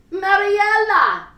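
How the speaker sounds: high, loud, long desperate call of a name; frantic cry. Acted. Older woman calling her lost daughter: frantic loud cry. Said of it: "A frantic voice cried out".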